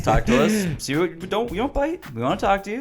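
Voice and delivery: playful invitation. Real, not acted. Friendly outro appeal.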